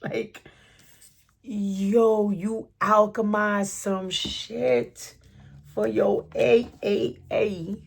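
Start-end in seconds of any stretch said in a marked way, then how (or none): none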